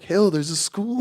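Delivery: high, loud, drawn-out mock alarm; squad's put-on voice. Soldiers yelling at Hill after the blast, high mock voice. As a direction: squeal it slowly like a panicking buddy. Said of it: "Why did you just do that voice?".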